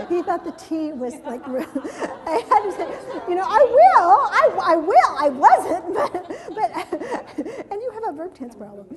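high, loud laughing speech; words pushed out through laughter; amused teacher laughing through the story; laughing while talking. Real, not acted.

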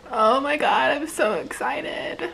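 normal talking voice

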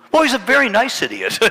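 high, loud, sudden sweetness; gushing backtrack. Retold or imitated. Macy's lady hearing of the big offering, hastily gushing. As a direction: flip to an exaggerated high approval. Acted lady's abrupt change of opinion.

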